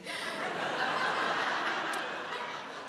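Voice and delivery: breathy silent wheeze of laughter. Real, not acted. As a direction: laugh without voice. Laughing at own joke.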